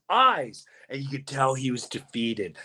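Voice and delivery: ordinary talk, nothing marked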